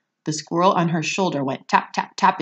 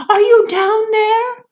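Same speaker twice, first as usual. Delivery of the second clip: very high, loud, slow calling; mother shouting down the chute; 10 dB louder than usual. Acted. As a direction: call down into the dark hole. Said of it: "shouted Missus Salt".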